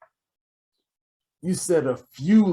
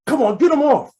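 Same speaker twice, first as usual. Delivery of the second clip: impatient demand; 12 semitones higher, 5 dB louder than usual. Acted. Gangster demands handcuffs off.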